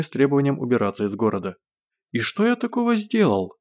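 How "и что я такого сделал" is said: high, mock-innocent question. A narrator's reading. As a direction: play the innocent, pitched up high. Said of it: "самым невинным тоном".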